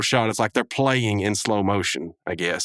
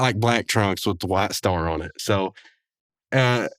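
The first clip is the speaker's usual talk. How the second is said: ordinary talk, nothing marked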